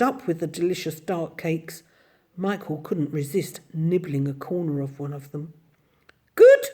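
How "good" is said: very high, loud delighted squeak. Acted. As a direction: squeak with joy. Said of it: "squeaked Missus Corey".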